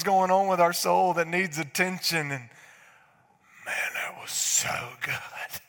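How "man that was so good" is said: slow whispered awe; hushed mock praise.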